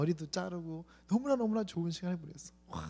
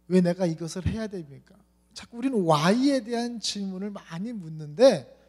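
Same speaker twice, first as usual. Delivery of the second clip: ordinary delivery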